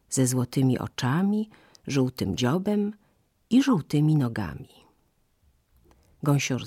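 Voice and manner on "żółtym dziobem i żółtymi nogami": ordinary delivery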